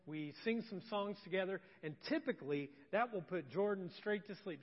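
usual speaking voice

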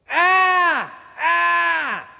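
put-on high, loud baby "ah, ah"; imitating a toddler's wordless call; toddler's reaching cry. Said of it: "when she goes like this".

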